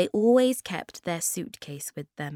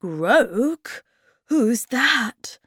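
mildly alarmed, louder questioning; slightly scared, raised voice; a bit frightened; 7 semitones higher, 7 dB louder, much slower than usual. A narrator's reading. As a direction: ask with a touch of fear. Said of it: "asked the Hemulin, feeling a bit frightened".

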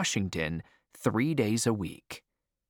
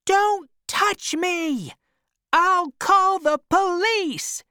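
high, loud shrieking protest; 7 dB louder, slower than usual. A narrator's reading. Madame Spinella yells at Jose as he pushes her, high and loud. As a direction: shriek at him to let go.

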